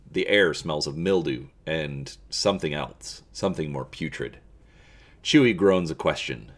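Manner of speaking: steady storyteller read; story narration. A narrator's reading. Reading a Star Wars gamebook to viewers, in a storyteller voice. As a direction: draw listeners into the dark scene.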